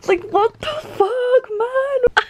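high, strained laugh-cry; words squeezed out through helpless laughter; tearful laughing disbelief. Real, not acted. Reader losing it over the manga, high laugh-crying.

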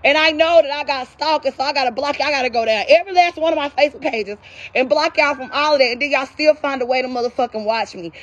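ordinary talk

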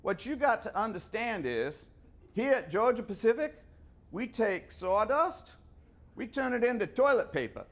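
put-on a folksy Southern executive lecturing a young salesman; Southern accent. Georgia executive scolding a young engineer, in a Southern accent. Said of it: "he had this southern accent, I can't even do it".

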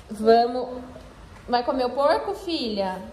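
ordinary talk

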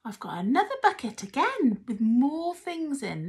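normal talking voice

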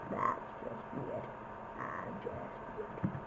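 whispered under the breath. Real, not acted. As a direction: let it slip out as a whisper. Whispered aside.

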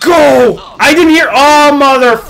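high loud frantic yelling; panicked shout. Real, not acted. Gamer overrun by spiders, panicked shouting. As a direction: scream it as you get overrun.

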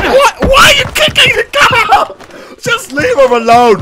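high, giggling protest; high protest broken by laughter; laughing outrage. Real, not acted. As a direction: laugh through your disbelief.

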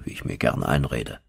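ordinary delivery, nothing marked

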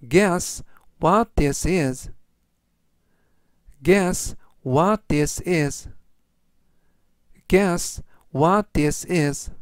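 slow, measured English for learners; teacher's deliberate, word-by-word pace; slow model reading. Shown as a demonstration. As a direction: read it out slowly for repeat-after-me. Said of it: "천천히 세 번씩 읽어드리고요".

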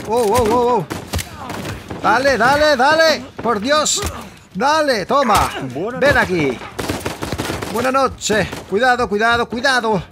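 high, loud frantic urging; panicked cheering on; excited yelling. Real, not acted.

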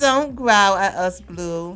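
half-laughing, loud telling-off; loud scold. Real, not acted. Player scolding her growling Sim, loud and laughing. Repeats the scolding much louder.

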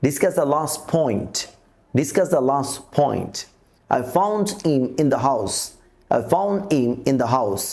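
put-on last point said as las point, found him as foun him, best boy as bes boy; sentence elision. Shown as a demonstration. Teacher reading practice sentences for learners, t and d left out. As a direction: drop t and d inside the sentence, said twice each. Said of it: "আমরা বাক্যের মধ্যে কিছু practice দেখি".

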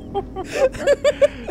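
high, loud shrieking laugh; cracking up at the scare; burst of laughter. Real, not acted. Laughing hard after the zombie scare, loud shrieks.

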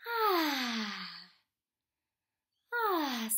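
long, loud falling "ahh"; relaxed sigh. Acted. Acting the relaxing frog, long relieved sigh. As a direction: let out a contented sigh. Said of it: "He's relaxing again".

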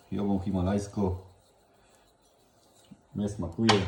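ordinary talk

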